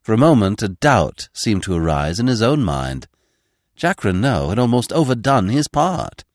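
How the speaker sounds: plain reading voice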